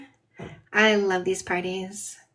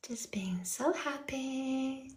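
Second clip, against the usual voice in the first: slow whisper; 2 semitones higher, 15 dB quieter, much slower than usual. Real, not acted. Whispering over the happy dogs.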